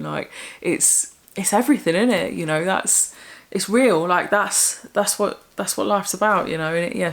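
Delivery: ordinary delivery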